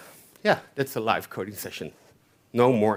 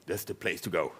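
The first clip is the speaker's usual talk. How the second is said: low, quieter mock conference-speaker voice; imitating Josh Long; 7 semitones lower, 11 dB quieter than usual. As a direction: drop your voice low and imitate a famous speaker's catchphrase. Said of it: "I cannot do it as good as him".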